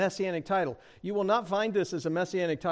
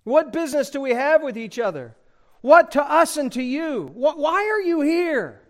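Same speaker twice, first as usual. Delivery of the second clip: loud, higher angry demand; angry demon; 6 semitones higher, 5 dB louder than usual. Acted. Demon in the synagogue confronting Jesus, angry and loud. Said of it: "And he is hot. He is angry".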